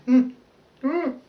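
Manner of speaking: high strained breath out, fighting tears. Real, not acted. Grieving her lost family, steadying herself from tears. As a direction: push the emotion out on a whew. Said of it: "I get so emotional when I talk about that".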